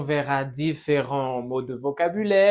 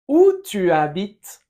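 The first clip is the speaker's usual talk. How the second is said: slow, louder teacher model; slow model phrase; 6 dB louder than usual. Shown as a demonstration. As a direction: say it slowly and loudly for the class. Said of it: "Répète". (frase modelo lenta y fuerte)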